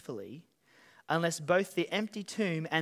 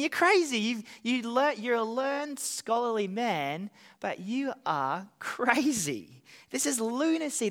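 high, loud, mocking outburst at Paul; scoffing governor; 4 semitones higher, 3 dB louder than usual. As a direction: sneer it out like a governor who thinks Paul is mad. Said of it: "He's saying".

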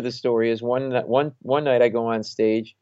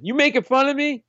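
high-pitched indignant challenge; mock drunken outrage; drunk and offended; 13 semitones higher than usual. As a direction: act out an affronted drunk heckler. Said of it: "And he's drunk".

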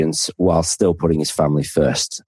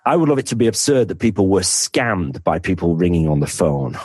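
ordinary talk, nothing marked

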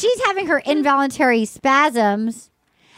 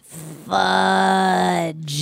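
mock disappointed fudge; 7 semitones lower than usual. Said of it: "Christina Mandrell goes".

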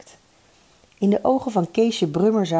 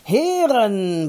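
stretched, falling formal address; solemn; 4 semitones higher than usual. A narrator's reading. The chairman tries once more to start, solemn=. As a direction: begin the speech once more with ceremony. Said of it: "riep heel plechtig".

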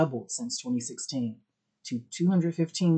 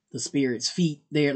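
normal talking voice

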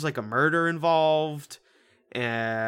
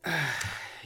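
soft audible sigh; 2 semitones higher, 4 dB quieter than usual. Real, not acted. Co-host mulling over the villain's odd accent, soft sigh. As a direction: let out a quiet breath before answering. Sighs before answering.

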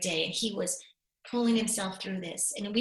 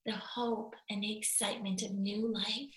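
usual speaking voice

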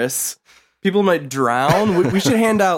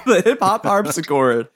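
ordinary talk, nothing marked

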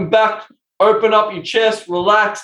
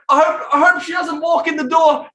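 higher, raised worried voice; boy fears mum walks in; anxious teen; 10 semitones higher than usual. Retold or imitated. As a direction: mutter the worry fast. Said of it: "she's like".

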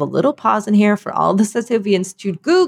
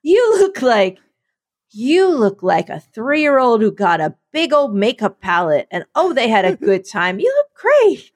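fake-sweet backhanded praise; mock catty compliment. Mock-roasting Dan's outfit.

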